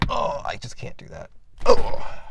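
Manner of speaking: real fright at a spider, breathy and loud; real spider freak-out. Real, not acted. Reading tarot, spots a spider and freaks out loudly. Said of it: "no one saw me freak out there".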